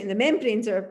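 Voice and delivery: ordinary delivery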